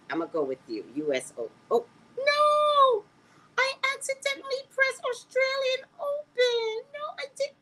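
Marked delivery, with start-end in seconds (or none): 1.7-7.5 high, loud wail of dismay at her own mistake; real panic over a wrong button, voice shooting up; dismayed cry. Real, not acted. She wails to the viewers after pressing the wrong quiz answer, high and dismayed.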